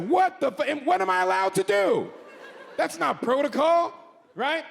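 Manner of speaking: high-pitched, indignant bewilderment; acted disbelief. Acting out his shock at the pat-down.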